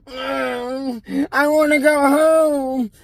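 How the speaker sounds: high, slow, put-on whimper of a grown man; mock a big baby begging to go home; whiny grown man. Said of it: "he starts getting all whiny".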